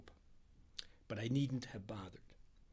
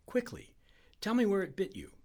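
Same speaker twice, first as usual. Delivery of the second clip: high, louder, anxious demand; urgent pressing; 8 semitones higher, 6 dB louder than usual. A narrator's reading. Friend demands to know about the bite, urgent pressing. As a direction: press for an answer fast.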